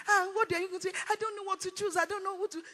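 high, wavering-minded mock reply; indecisive answer. Someone asked to choose between life and death, mock helpless. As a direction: whine helplessly on a high pitch. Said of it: "So sometimes they will ask you".

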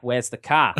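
understated New Zealand accent. Shown as a demonstration. Guest compares how New Zealanders say car, New Zealand accent. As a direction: show the New Zealand version. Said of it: "Whereas in New Zealand they're like".

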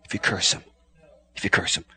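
hushed whisper, If you curse him. Shown as a demonstration. Preacher whispering a warning to the congregation. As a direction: breathe it out low.

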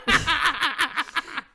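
loud burst of laughter. Real, not acted. Laughing burst mid-chat.

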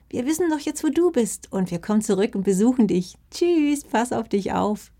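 calling back while fading away; fox mother calling goodbye. Acted. (hohe rufende Fuchsmama)

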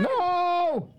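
high startled no; shocked cry. Real, not acted. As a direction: yelp in dismay. Beer spilled.

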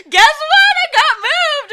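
very high, slow, loud wailing; calling her boyfriend in mock tears; mock sobbing. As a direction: sob it down the phone. Retelling tearful call to Andrew.

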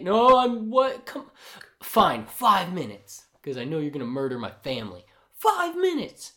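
exasperated giving in. Retold or imitated. Retelling a character conceding five minutes, hammed-up exasperation. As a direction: cave in with a high, exasperated cry.

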